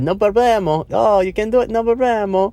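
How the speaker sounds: squeaky high little Minion voice, cheerful and eager. Retold or imitated. Minion offering to heat the coffee, high squeaky voice. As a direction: chirp it up high like a cartoon Minion. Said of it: "and he said".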